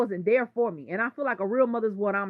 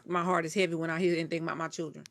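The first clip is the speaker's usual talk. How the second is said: usual speaking voice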